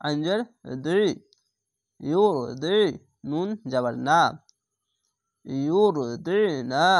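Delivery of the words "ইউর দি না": ইউর দি না with the ain in দি said thick, squeezed from the throat, very slowly; heavy throaty ain. Shown as a demonstration. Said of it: "আইনটিকে মোটা করে পড়বেন, গলা চিপে পড়বেন". (গলা চিপে মোটা করে আইন উচ্চারণ)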